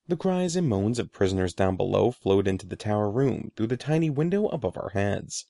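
ordinary delivery, nothing marked

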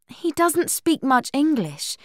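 high-pitched, indignant protest; angry. A narrator's reading. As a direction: argue back crossly in a high voice. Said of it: "said Buffy angrily".

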